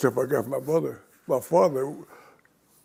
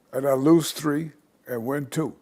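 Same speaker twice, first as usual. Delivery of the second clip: usual speaking voice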